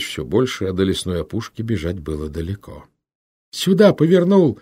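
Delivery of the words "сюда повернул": high, loud panting shout; breathless shout. A narrator's reading. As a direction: yell it while gasping for air. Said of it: "задыхаясь, крикнул Минька".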